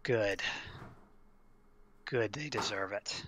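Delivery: soft, satisfied and grim; quiet grim approval. Acted. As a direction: murmur it with quiet vindication. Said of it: "She nods and says".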